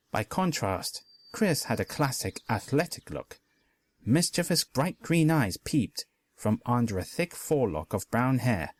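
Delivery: plain reading voice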